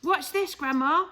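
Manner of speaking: put-on a boy eager to show off; excited shout. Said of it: "he shouted".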